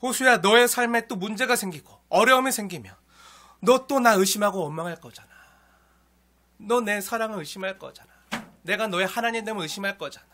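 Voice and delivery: God gently chiding a doubting servant; loud fatherly rebuke from God; God rebuking. Retold or imitated. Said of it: "말씀하시는 거예요".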